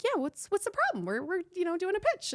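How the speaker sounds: very high, falsely casual squeak; retold nervous high-voiced excuse; voice goes up. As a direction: play innocent with the pitch shooting up. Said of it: "my voice goes up".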